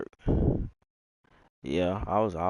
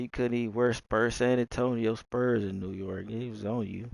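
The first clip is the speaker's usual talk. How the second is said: ordinary talk, nothing marked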